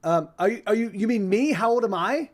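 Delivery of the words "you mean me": normal talking voice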